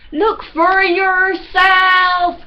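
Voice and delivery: high, loud, slow drawn-out shout. A narrator's reading. Judd shouting at Demelza as the tree cracks, high and loud. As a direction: bellow it with alarm. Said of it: "shouted Judd".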